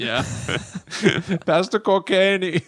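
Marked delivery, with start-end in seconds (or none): 1.4-2.6 slow, higher, laughing German Freud; mock German accent. Imitating Freud asking for cocaine, laughing mock German accent. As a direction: drawl it through giggles in a comic German accent. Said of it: "That's my German accent".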